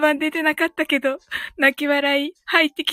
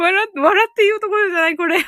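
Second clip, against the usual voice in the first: louder and fast, laughing it out; loud laughing retort; 5 semitones higher, 5 dB louder, faster than usual. Real, not acted. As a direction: blurt it through laughter. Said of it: "笑って言うところじゃないけど".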